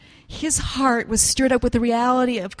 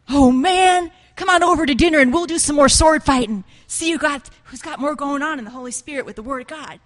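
mock eager challenge; 4 semitones higher, 6 dB louder than usual. Mocking rivalry among believers.

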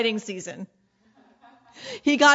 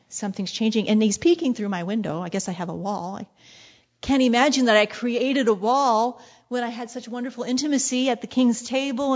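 ordinary delivery

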